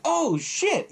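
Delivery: high-pitched, drawn-out amazement; retold amazed reaction. Viewer reacting to a gory kill, hammed-up shock.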